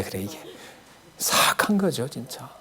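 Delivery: breathy hushed aside to the congregation; whisper. Real, not acted. Preacher whispering to the congregation that it is wicked. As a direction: drop to a secret whisper, as if sharing something sinister.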